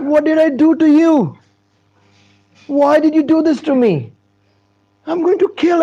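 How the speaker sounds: high, loud anguished protest; hammed-up panicked complaint; acted distress. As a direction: wail the accusation in mock despair. King confronts Narada in panic.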